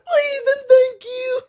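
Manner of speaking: laughing, high, loud mock wail; hammed-up crying plea, half laughing. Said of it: "Denki wails again".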